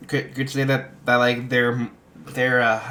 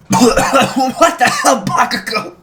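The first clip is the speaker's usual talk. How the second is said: high, loud, slow shriek; outraged yell; 12 semitones higher, 7 dB louder, slower than usual. Retold or imitated. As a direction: scream it at Bakugo in shock. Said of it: "he's like".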